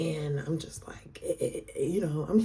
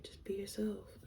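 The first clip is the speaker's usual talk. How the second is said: whisper; 3 semitones higher, 13 dB quieter than usual. Retold or imitated. Boyfriend's quiet curt reply.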